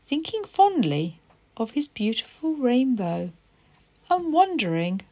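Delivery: storybook read-aloud voice; story narration. A narrator's reading. Teacher reading a story to pupils, gentle storytelling. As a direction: read the picture book to children.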